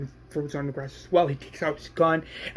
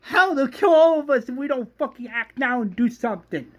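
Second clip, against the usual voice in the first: high, urgent raised voice; urgent outburst; 8 semitones higher, 3 dB louder than usual. Acted. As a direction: argue urgently for action. Acted angry outburst in story.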